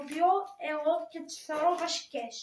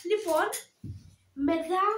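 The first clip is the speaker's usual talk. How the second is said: normal talking voice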